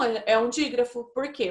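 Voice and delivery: normal talking voice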